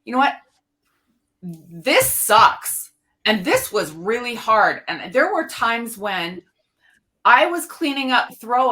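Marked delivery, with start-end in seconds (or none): 0.0-4.8 blunt and raw. Retold or imitated. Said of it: "get down and dirty with me and say".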